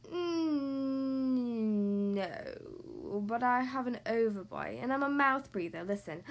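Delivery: drawn-out low creature voice; low breathy monster. A narrator's reading. Monster admits he has no fangs to the child, low and breathy. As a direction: hum over it, then admit it low and breathy.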